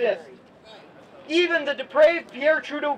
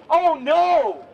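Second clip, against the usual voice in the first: louder, incredulous "oh no"; 3 semitones higher, 5 dB louder, much slower than usual. Retold or imitated. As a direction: scoff as if the idea is absurd. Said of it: "with an incredulous tone".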